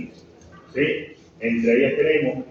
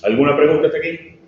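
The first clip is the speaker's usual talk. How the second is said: louder, quick question thrown to the room; raised voice asking the class for questions; calling out to class; 7 dB louder, faster than usual. Real, not acted. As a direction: call it out to the whole class. Teacher calls out to class.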